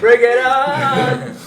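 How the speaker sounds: high, loud, drawn-out sung yell; shouting. Acted. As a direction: belt it out as a defiant challenge. Leaving door wide open defiantly.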